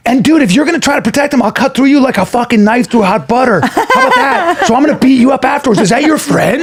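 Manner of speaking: retold angry threat. Man threatens bouncer guarding heckler: laughing mock threat. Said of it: "I'm so angry. I go".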